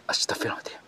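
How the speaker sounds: hushed, unvoiced astagfirullahaladzim; whispered prayer. Real, not acted. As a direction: whisper it in alarm. Finds something stuck in him.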